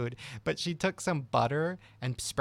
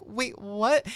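high, louder mock disbelief; retold bafflement; 10 semitones higher, 5 dB louder than usual. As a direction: act out a taken-aback reply. Retold man's baffled reply.

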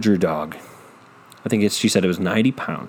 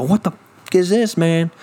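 higher mock yell at the dog; hammed-up angry owner; 7 semitones higher than usual. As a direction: scold the dog over the mess.

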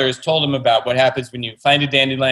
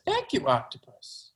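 put-on higher, soft little-boy thanks; small boy voice; 6 semitones higher, 10 dB quieter than usual. Author voicing the boy in his book, soft and higher. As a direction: say it like a sleepy kid, softly. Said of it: "And the boy says".

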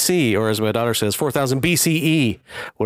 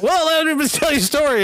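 high mock voice; 13 semitones higher than usual.

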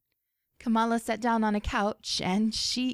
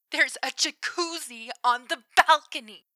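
excited; 5 semitones higher, 4 dB louder than usual. Acted. Excited texting.

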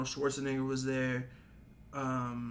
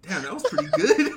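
louder, higher impressed reaction; amused, raised-voice mimic of being impressed; laughing retold praise; 5 semitones higher, 10 dB louder than usual. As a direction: quote the surprise through laughter. Retells wife's impressed reaction, laughing.

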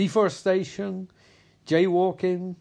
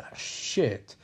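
whispered; 4 semitones lower, 4 dB quieter than usual. Retold or imitated. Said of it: "the whole audience is like".

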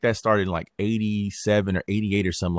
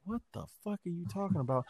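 muttered disbelief; 8 semitones higher, 12 dB quieter, faster than usual. Retold or imitated. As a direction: mumble it as if under his breath beside the president. Fauci's face at the president.

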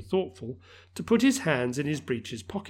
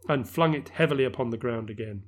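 plain reading voice

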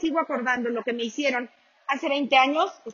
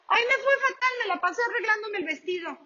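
a high, whining complaint; whiny guest; 6 semitones higher, faster than usual. Retold or imitated. Guest moaning to a friend about her wedding, mock whine. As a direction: moan about the dress, mock-tragic.

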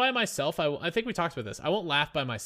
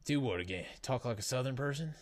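put-on Southern accent; 5 semitones lower, 8 dB quieter than usual. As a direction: play the Southerner, pitched down. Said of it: "that's pretty Southern".

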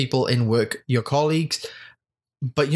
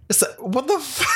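laughing disbelief; 12 semitones higher than usual. Real, not acted.

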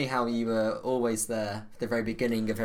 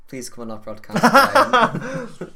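laughing plea; 9 semitones higher, 7 dB louder, much slower than usual. Real, not acted. Begging guest, laughing.

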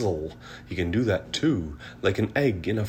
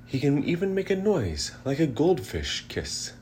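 plain reading voice

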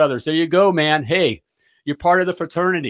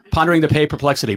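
said fast; faster than usual. Shown as a demonstration. Host rattling the title off fast for his viewers. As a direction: rattle off the tongue twister quickly. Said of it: "Can you guys say it fast?".

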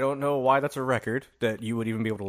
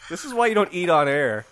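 normal talking voice

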